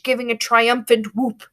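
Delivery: ordinary delivery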